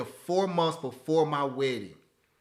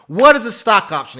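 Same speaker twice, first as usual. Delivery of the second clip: loud question; 5 semitones higher, 13 dB louder than usual. Real, not acted. Loud rhetorical question to viewers.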